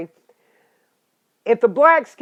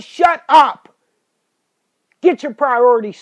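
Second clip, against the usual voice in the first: sudden loud, higher snap of shut up; angry shout; 3 semitones higher, 7 dB louder than usual. Real, not acted. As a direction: cut them off with a furious shout. Ranting at imagined excuse-maker.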